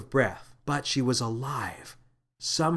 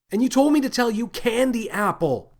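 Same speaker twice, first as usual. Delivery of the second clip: higher and louder, words tumbling out; blurted out; 11 semitones higher, 6 dB louder than usual. Acted. Lily blurts the code word to the captain, high and loud. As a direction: blurt it in a panicked rush. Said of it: "Lily blurted out".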